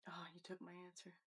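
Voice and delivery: hushed, voiceless grumble; very soft whispered complaint; whispered aside. Real, not acted. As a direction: whisper it under the other speaker. Aside after co-host answers first.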